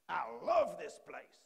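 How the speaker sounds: loud, slow, high mock-American gushing; big, drawn-out imitation American accent; attempted American accent. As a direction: belt it out like a hammed-up American. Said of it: "attempt to do an American accent".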